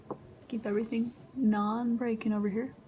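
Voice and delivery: ordinary delivery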